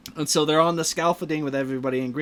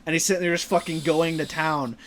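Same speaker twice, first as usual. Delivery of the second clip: ordinary delivery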